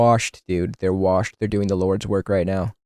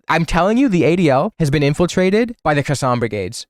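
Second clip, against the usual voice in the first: normal talking voice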